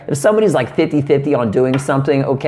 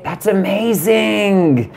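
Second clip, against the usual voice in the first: slow, drawn-out "That's amazing" feigning interest; fake-interested partner; fake amazed; 5 semitones higher, much slower than usual. Shown as a demonstration. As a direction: stretch "amazing" to sound impressed. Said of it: "you need to say".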